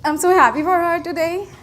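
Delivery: fighting back tears of joy; real emotion breaking through; choked up. Real, not acted. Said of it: "I'm sorry, I'm very emotional".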